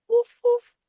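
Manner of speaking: high, loud playful woof woof; dog barking. Acted. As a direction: bark like a cheerful puppy. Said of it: "Pop barks".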